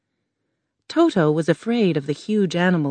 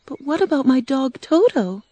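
put-on higher, girlish Dorothy voice; a worried little girl asking, pitched up; young girl Dorothy; 8 semitones higher than usual. Dorothy asking the Tin Man, girlish and higher.

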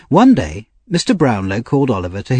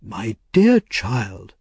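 very slow, tender address; kind old gentleman; much slower than usual. A narrator's reading. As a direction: soothe the child, drawing out each word. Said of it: "said the old gentleman".

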